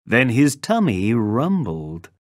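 ordinary delivery

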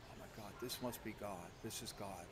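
hushed and low, half-whispered awe; awed hush. Retold or imitated. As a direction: breathe it out in stunned reverence. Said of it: "I'm like".

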